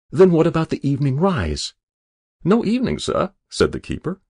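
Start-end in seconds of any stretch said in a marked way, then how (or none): 0.1-1.7 louder, higher incredulous question; dismayed question. A narrator's reading. Castwell seeking a break from fishing, dismayed. Angler's rising dismay at answers. 2.4-3.3 high-pitched, polite denial; deferential keeper. A narrator's reading. Keeper to angler hoping for rest, deferential denial.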